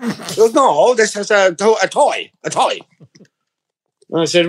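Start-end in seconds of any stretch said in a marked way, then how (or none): 0.5-2.8 higher-pitched mock Chinese shopkeeper accent. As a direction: imitate the shop owner's Chinese accent. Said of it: "if you do an accent of a foreign person".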